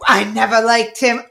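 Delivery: hammed-up shock. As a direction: declare it in mock horror, loud and high. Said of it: "And they're all like".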